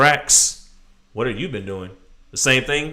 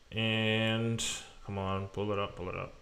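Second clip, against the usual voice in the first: muttering to himself; 4 semitones lower, 15 dB quieter than usual. Real, not acted. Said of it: "muttering while loading a file".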